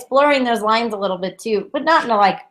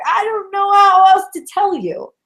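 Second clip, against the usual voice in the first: high mock exasperation; frustrated; 9 semitones higher, 4 dB louder than usual. Guides answering a confused client, frustrated. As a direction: answer back at wits' end, pitch raised. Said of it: "they're as frustrated as you are".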